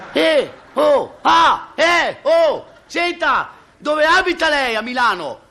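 very high, long-carrying holler; comic castaway yelling on one high pitch; calling from afar. Acted. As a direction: shout joyfully to someone far across the water. Castaways hail each other from afar.

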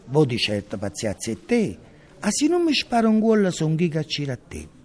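loud, rhythmic stage recitation; hammering the lines out to an audience; theatrical declamation. Acted. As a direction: declaim it like a grotesque chant on stage.

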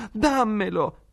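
put-on a spoiled boy screaming for what he wants; sick son screams at his mother for the piglet; shrieking demand. A narrator's reading. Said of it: "tese le braccia gridando".

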